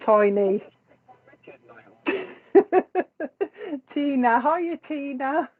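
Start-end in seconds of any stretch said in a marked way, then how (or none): 2.5-3.9 long high giggle; real laugh. Real, not acted. Tickled by nicknaming Richard Tiny, laughing. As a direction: giggle away at your own joke.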